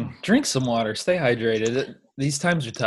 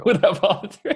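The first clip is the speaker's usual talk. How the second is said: laughing; 7 semitones higher than usual. Real, not acted. Echoing the line while laughing.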